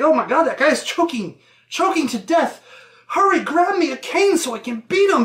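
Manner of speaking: high-pitched, hammed-up alarm; mock panic. Gentleman calls for a cane to beat choking man, mock panic. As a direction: exclaim in urgent mock distress.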